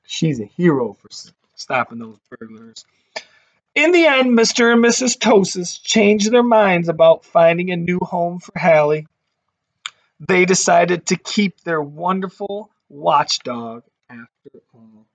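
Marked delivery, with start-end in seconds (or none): 3.8-13.8 put-on lively read-aloud voice for kids; storybook narration. A narrator's reading. Teacher reading a picture book to her class, lively storytelling.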